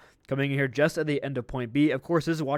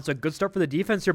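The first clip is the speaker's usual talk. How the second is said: normal talking voice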